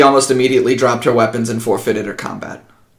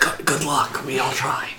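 very soft, near whisper; hushed reply; 11 dB quieter than usual. Pretend. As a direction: answer under your breath. Whispered reply in silent crowd.

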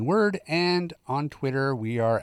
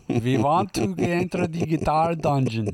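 a light, hammed-up bad German accent; mock German tourists demanding entry, in a mildly put-on German accent; terrible German accent; 4 semitones higher than usual. Joking about fetish-gear Germans at the door, in a bad German accent. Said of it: "That was a terrible German accent".

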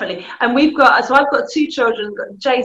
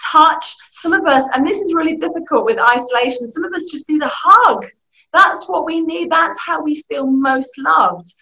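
ordinary delivery

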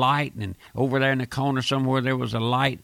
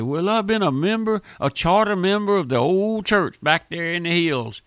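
CHARTER MEMBER stressed in a higher voice; emphatic boasting imitation; 5 semitones higher than usual. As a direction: mimic a proud old-timer, leaning on "charter member". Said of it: "They put a lot of emphasis on that".

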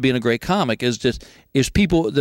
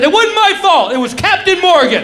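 pleading excuse; 13 semitones higher, 7 dB louder than usual. Retold or imitated.

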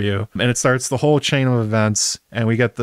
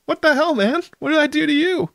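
squeaky, much higher voice of an outraged younger brother; shocked Aegon. Retold or imitated. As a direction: play a betrayed brother yelping in disbelief, high. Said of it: "And Aegon's like".

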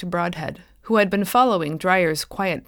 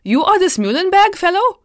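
much higher and louder, stammering in alarm; frightened cry of disbelief; horrified; 13 semitones higher, 8 dB louder than usual. Acted. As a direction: blurt it out in panic. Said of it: "he gasped".

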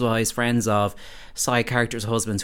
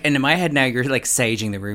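ordinary talk, nothing marked

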